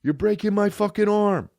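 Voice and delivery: high, pained mock yell; imitated scream. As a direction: cry out like security is pinning you. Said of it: "this kid screaming".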